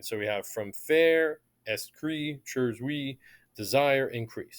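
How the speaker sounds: syllable by syllable, each beat marked; split into syllables. Shown as a demonstration. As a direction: chop the line into its metric feet. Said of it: "we're marking the unstressed, stressed".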